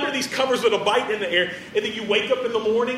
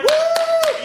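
high, loud church-dance whoop; joyful whoop; 6 dB louder than usual. Retold or imitated. Woman overjoyed at news of a son, loud high whoop. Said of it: "this woman would be like".

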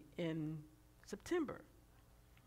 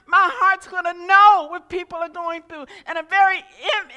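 loud, higher, rising preaching voice close to tears; impassioned preaching; 11 semitones higher, 18 dB louder than usual. Acted. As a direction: preach it with tears behind the words. Emotional preaching climax.

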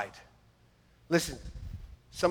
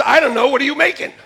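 wife answering; 8 semitones higher, 10 dB louder, faster than usual. Retold or imitated. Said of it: "She goes".

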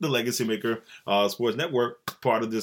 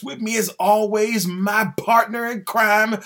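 higher, slow, stretched-out showman build-up; hype introduction; 10 semitones higher, 3 dB louder, slower than usual. Acted. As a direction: build up the co-host like a ring announcer. Hyping up his co-host.

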